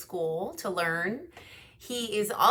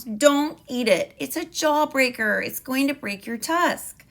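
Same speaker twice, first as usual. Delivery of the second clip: higher, louder mouse voice warning; 6 semitones higher, 4 dB louder than usual. Acted. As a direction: warn him as the worried little mouse. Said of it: "squeaked Mister Mouse".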